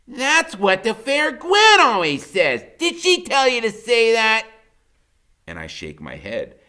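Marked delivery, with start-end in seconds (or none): 0.1-4.5 put-on high, loud, slow suspicious boy; suspicious Freak. A narrator's reading. Freak wondering if Gwen sent him, high slow voice. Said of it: "he gets this funny look and he goes".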